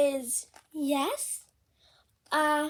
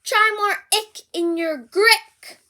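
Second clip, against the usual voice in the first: eager trumpet lesson; 7 semitones higher, 11 dB louder, slower than usual. Acted. Elephant lesson.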